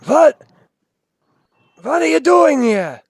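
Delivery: high, loud ghostly voice from the rubble; eerie wind voice. Acted. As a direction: call out like a spirit in the wind. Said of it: "this voice of the wind".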